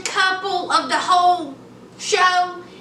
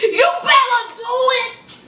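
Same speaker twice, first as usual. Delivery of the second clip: excited shout; 6 semitones higher than usual. Real, not acted. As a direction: yell it like cheering them on. Her own reaction, cheering.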